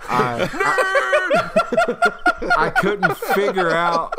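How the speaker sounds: high, loud, breaking up with laughter; laughing while talking. Real, not acted. Player confessing he couldn't pick a character voice, laughing hard. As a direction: squeeze the words out through helpless laughter.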